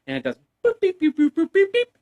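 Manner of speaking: high sing-song beep boop; mock computer beeps. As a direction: chirp it like a scanning machine. Imitating facial recognition computer.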